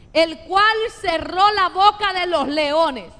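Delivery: fervent preacher calling scripture out; preaching at full force. Acted. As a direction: proclaim every verse to the congregation.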